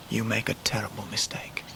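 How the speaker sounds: low whispered warning; hushed warning. Acted. David warning the proud mother in a whisper. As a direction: breathe it out as a grave warning. David warns Mrs Steerforth gravely.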